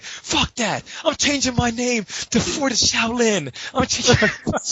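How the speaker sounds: high, slow indignant rant; mock outburst. Imitating Rio in the car to the tournament, high huffy rant. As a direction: pitch it up like a huffy tantrum. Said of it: "Rio was like".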